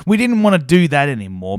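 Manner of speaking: ordinary talk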